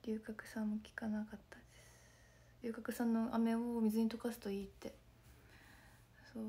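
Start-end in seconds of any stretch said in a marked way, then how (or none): 0.0-2.6 whispered through a worn-out voice; real strained whisper after shows; hoarse voice. Real, not acted. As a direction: spare a hoarse throat with a whisper. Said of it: "声ガサガサすぎる".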